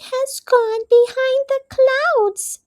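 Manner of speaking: high, small mouse voice; little Mouse voice. A narrator's reading. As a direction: speak as a tiny gentle mouse. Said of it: "said Mouse".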